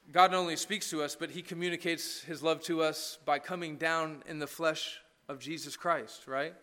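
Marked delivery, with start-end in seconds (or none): none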